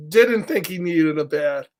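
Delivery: usual speaking voice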